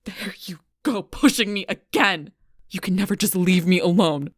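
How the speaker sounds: loud, exasperated outburst; shouting in frustration; angry accusation. Acted. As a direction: lash out loudly, fed up. Heated argument, accusing.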